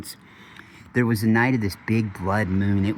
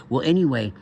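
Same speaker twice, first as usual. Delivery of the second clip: ordinary delivery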